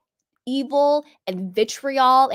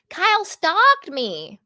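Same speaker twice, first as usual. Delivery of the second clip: higher, drawn-out put-on voice; 6 semitones higher, slower than usual. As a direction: stretch it out in a raised mimicking voice. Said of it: "In my [name] voice".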